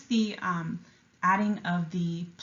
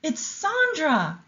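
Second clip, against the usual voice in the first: higher, slow thrilled cry; a starstruck fan spotting her; excited scream; 9 semitones higher, 4 dB louder, slower than usual. A narrator's reading. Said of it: "someone suddenly screamed".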